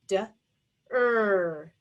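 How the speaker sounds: "d, er" as two separate sounds, short d then long er; teacher isolating each sound of "d, er". Shown as a demonstration. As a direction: break DER into "d", a gap, then a held "er". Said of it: "Second syllable".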